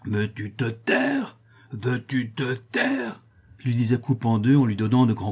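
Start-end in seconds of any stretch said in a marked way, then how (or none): 0.1-3.2 menacing hush. Acted. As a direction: hiss it slowly, kicking him quiet. Master silencing the crying boy.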